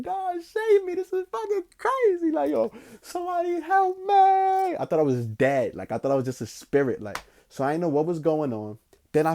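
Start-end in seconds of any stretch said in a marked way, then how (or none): 0.0-4.8 much higher, desperate cry for help; panicked plea. Retold or imitated. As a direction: beg God to save you, high and strained. Retold scream to God.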